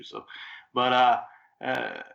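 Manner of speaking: ordinary delivery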